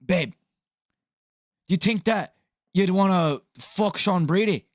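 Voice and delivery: exaggerated, inaccurate Irish accent; botched Irish accent. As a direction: do a bad mock Irishman. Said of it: "Not what he sounds like, by the way".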